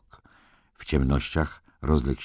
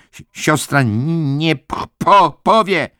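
high, loud, halting plea; panicked stammer; 13 semitones higher, 8 dB louder, slower than usual. A narrator's reading. Billy begging the nurse not to tell, stammering in panic. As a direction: stutter desperately on the first sounds, slowly forcing words out.

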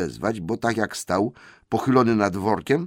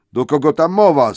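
much higher, louder demand; loud troll question; 8 semitones higher, 9 dB louder than usual. Acted. William loudly asks who is talking. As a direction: demand to know who spoke.

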